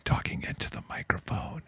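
mock whisper. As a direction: breathe it out like a creepy whisper. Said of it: "he started whispering".

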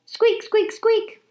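high squeaky little hamster voice; hamster squeaks. Acted. Hamster squeaking at the janitor. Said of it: "all that came out was".

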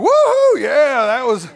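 high, loud whooping with a laugh; hammed-up loud hollering with laughter; whooping Ray. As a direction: whoop it up like a rowdy guy. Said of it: "Ray was like".

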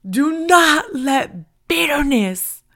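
loud, high preacher's shout, stretched out slow; emphatic repeat. Real, not acted. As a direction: ring it out emphatically, word by word. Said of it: "I will say that again".

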